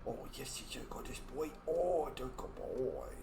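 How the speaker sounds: normal talking voice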